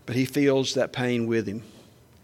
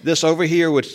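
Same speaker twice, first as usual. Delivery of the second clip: higher and louder, scolding imitation; mama's lecturing voice; 5 semitones higher, 6 dB louder than usual. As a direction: play a mother telling God off. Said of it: "that was my mama talking".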